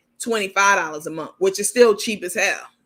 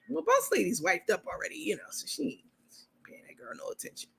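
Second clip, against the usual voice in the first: fading to whisper; 4 semitones higher, 9 dB quieter, faster than usual. Real, not acted. Gossip trailing into whisper.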